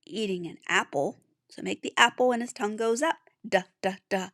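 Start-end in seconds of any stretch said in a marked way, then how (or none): 3.5-4.3 Duh, duh, duh as short clipped d sounds; letter sound d. Shown as a demonstration. Teacher shows the digging dog's sound, short d taps. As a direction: tap out the d sound three times. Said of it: "as you write his sound".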